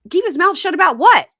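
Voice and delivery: high, loud incredulous outburst. Real, not acted. Host scoffing at a vague threat, loudly incredulous. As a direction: fling the question out, loud and high.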